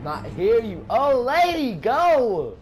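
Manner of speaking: loud, high, frantic yells; panicked yelling. Real, not acted. Streamer fleeing the monster, yelling in panic. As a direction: shout in panic while fleeing.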